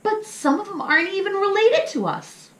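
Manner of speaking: put-on high, indignant child voice; protesting little pup. Little pup protesting to mama about the pack. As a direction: object in a high puzzled voice.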